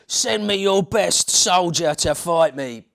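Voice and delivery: loud, slow Cockney bellow; Cockney brute calling out a challenge; Cockney giant. Acted. Goliath challenging the army in a loud Cockney voice. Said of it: "was a Cockney from East End of London".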